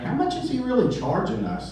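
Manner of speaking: a guest doing the sums in his head; suspicious client. Retold or imitated. Clients wonder about cost.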